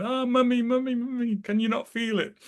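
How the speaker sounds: put-on high little-child voice, earnest; children pleading to mummy. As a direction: imitate a small child begging mum to feel it. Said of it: "the children are there feeling".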